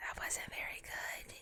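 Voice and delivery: hushed whisper of a disgusted character. Acted. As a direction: whisper it, sour and unimpressed. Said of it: "as he goes".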